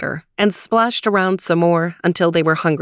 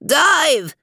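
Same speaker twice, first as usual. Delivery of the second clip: yelled command; 9 semitones higher than usual. Acted. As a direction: shout it like a sub captain. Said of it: "The Weezer appeared and yelled".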